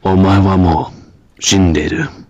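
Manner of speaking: low, loud mock-Japanese growled threat; anime tough-guy line. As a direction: play a deep, menacing fighter. Imitating game voice sample.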